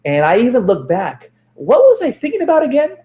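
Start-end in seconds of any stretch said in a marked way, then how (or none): 1.5-3.0 high, puzzled question; a self-mocking confused question. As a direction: wonder aloud what you forgot. Acts out confused forgetful self.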